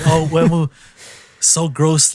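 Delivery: high, loud reaction voice; mock alarm. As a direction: imitate an onlooker making a fuss. Imitating reaction to squeamish contestant.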